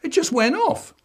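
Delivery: put-on high whiny kid voice; boy pleading innocence to his dad; child voice. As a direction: squeak it out like a guilty little boy. Child's catchphrase repeated.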